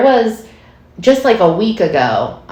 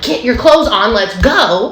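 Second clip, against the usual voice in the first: impatient mom; 6 semitones higher than usual. Retold or imitated. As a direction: rush the kids along, a touch of irritation. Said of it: "as I'm like".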